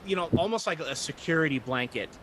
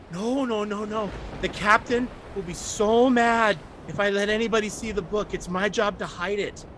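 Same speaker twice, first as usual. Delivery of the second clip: anxious pleading; 7 semitones higher, 4 dB louder than usual. Acted. Child afraid of captain.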